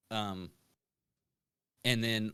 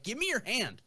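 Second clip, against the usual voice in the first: bartender; 12 semitones higher than usual. Retold or imitated. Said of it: "they're like".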